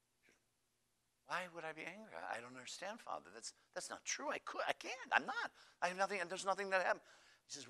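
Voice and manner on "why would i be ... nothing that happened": high, stammering acted protest; flustered, disbelieving nun; bewildered denial. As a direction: sputter your innocence, climbing higher. Accused nun denies being angry.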